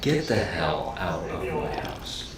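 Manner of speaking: slow, low, soft words after an exasperated laugh; so mad it turns into laughter, drawn out quietly; laughing from anger. Shown as a demonstration. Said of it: "they just start laughing".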